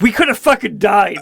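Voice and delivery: mock mad outburst. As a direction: act out scolding a friend for nearly getting you killed. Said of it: "you just get mad at him".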